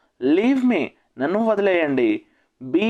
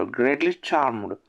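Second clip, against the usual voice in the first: slow repeat; 2 semitones lower, much slower than usual. Shown as a demonstration. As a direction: stretch it out so learners catch it. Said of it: "teacher repeats phrase for learners".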